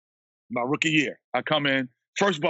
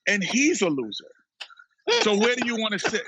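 pointed, higher-pitched call-out of each one; retold coach's challenge; 6 semitones higher than usual. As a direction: imitate a coach singling people out, one by one. Coach singles out players as losers.

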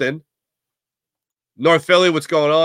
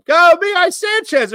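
calling out; 5 dB louder than usual. Real, not acted. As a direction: stretch the name out high. Host calls out chatter's name.

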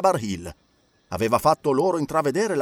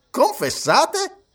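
high, very slow incredulous question; can't believe he confesses; surprised judge; 14 semitones higher, 4 dB louder, much slower than usual. A narrator's reading. (giudice incredulo, acuto e lentissimo)

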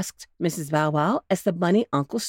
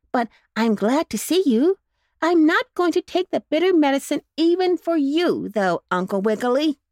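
higher, sulky puppy voice; a sick pup digging in his heels; lightly voiced stubborn puppy; stubborn puppy boy; 9 semitones higher, 4 dB louder than usual. A narrator's reading. Jackie refuses medicine.

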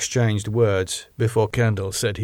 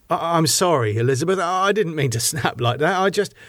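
higher-pitched, stumbling apology; hasty apology; 6 semitones higher than usual. A narrator's reading. David apologizing to Elizabeth for snapping, hastily apologetic. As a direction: backpedal at once after snapping. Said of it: "David immediately apologized".

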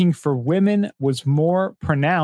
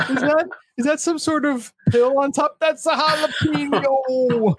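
hammed-up comedian voice, laughing through it; Bill Cosby impression; 11 semitones higher than usual. Continuing the Cosby bit.

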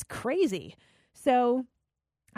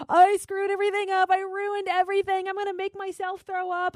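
high-pitched retold wail of distress; mock bawling; 10 semitones higher than usual. Woman calling her boyfriend after a diet binge, mock bawling. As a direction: wail it in a high voice, imitating a tearful meltdown. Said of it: "I was bawling, crying".

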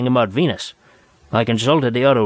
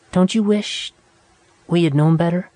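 put-on young woman voice; 6 semitones higher than usual. A narrator's reading. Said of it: "Nada speaking".

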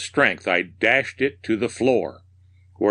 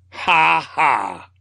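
high, mad cackle in two bursts; theatrical villain's "ha, ha"; raving laugh; 6 semitones higher, 3 dB louder than usual. A narrator's reading. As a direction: shout out a crazed, triumphant laugh. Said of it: "I ceased raving".

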